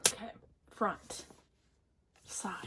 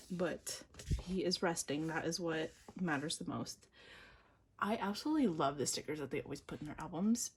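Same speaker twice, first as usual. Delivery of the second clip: ordinary talk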